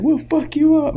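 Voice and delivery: high, loud rowdy threat; mock threat. Drunk dad threatening someone in the club, high and loud. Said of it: "He's like".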